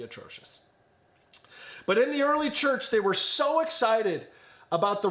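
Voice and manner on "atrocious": whispered aside. Real, not acted. Self-deprecating aside.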